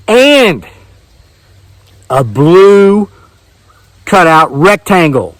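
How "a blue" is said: usual speaking voice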